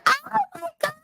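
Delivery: shrill-free high squeal from across the room; a distant relative's delighted high cry; high call of recognition. Retold or imitated. Old aunt spotting her at the wake, high excited call.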